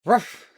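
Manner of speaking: high yappy ruff; dog bark. Pretend. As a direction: bark like a puppy. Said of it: "I'm trying so hard".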